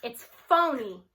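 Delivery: slow, flat-out dismissal; curt retort. Acted. Superintendent brushing off the teacher, slow curt retort. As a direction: cut her off with a slow, cold jab. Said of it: "he retorts".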